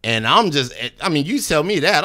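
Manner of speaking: usual speaking voice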